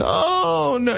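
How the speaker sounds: very high wailing oh no; a mock anguished old aunt cry; aunt dismayed. Aunt hearing he's Republican, very high mock wail. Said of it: "you would have thought I was stabbing her cat".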